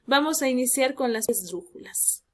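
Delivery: ordinary talk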